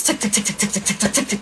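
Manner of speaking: soft low "tick tick" imitation; code ticking. Showing how they send codes, soft ticking imitation. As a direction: tick it out quietly like a signal. Imitating their coded signals.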